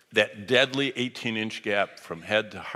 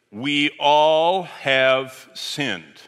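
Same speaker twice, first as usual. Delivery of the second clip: each word dragged out slowly, louder; slow halting reading; 4 semitones higher, 8 dB louder, much slower than usual. Retold or imitated. To the pastor, a church man reads the verse aloud in a very slow halting voice. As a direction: read it out word by word like a reluctant beginner.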